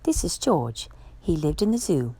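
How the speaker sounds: usual speaking voice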